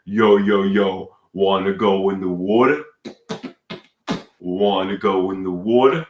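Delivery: put-on laid-on Brooklyn accent, slightly low; fake Brooklyn accent. Trying to impress a supermodel, fake Brooklyn accent. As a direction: ham up a Brooklyn come-on. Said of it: "I'm really putting on the accent".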